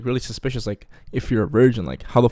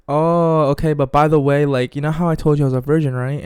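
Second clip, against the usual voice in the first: put-on higher, louder girl voice; mock girl's voice, pitched up; imitating the girl; 4 semitones higher, 4 dB louder than usual. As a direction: play the girl easing into a confession. Voicing the girl's confession.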